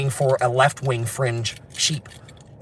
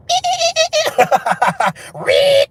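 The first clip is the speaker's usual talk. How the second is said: very high, loud stuttering bleat; baby sheep bleat; 6 dB louder than usual. Shown as a demonstration. Showing viewers small baby sheep sounds, high squeaky bleating. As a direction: squeal out tiny lamb bleats. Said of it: "small baby sheep sounds".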